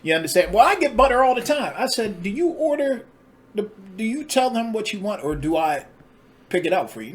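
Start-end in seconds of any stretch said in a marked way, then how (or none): 0.5-1.7 higher and louder, mocking her indignant tone; mock girlfriend voice. Man mimicking his girlfriend's huffy reply, high and mocking. 2.2-7.1 incredulous question. Retold or imitated. His own retort, quoted.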